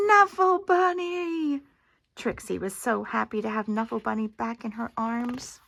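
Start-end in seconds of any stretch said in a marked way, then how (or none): 0.1-1.6 high, loud joyful cry; excited cry. Acted. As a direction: cry out in delighted surprise. Trixie finds lost bunny on plane.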